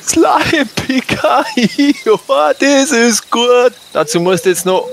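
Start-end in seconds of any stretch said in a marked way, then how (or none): 0.0-1.0 high mocking shout; gleeful shout. Robber mocks the name. 1.1-3.7 high gloating laugh, slow; mocking laugh. Robber laughs at the victim.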